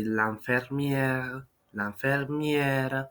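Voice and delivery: l'infirmière drawn out slowly, open è; slow French l'infirmière. Shown as a demonstration. Teacher slowly models the feminine form. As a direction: stretch out each syllable of l'infirmière. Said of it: "I put an accent on the first E".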